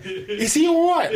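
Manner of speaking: very high, slow comic dog voice; bewildered dog. Retold or imitated. Dog kipping beside him asks about uncle, bewildered. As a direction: stretch it out high as the worried dog. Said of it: "the dog's kipping next to me going".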